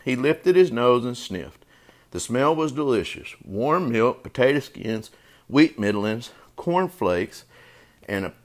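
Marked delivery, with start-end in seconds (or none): none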